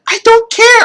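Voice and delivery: high, loud, carefree yell; excited shout. Real, not acted. Brushing off dislikes, loud high excited yell. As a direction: shout it out with glee. Hyped defiance.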